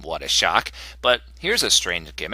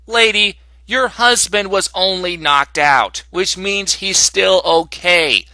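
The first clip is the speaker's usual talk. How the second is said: slow, high, emphatic scolding; exasperated lecture; 6 semitones higher, 3 dB louder, slower than usual. Acted. Reviewer scolding Mina over her husband, slow and exasperated. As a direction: spell it out slowly for someone not getting it.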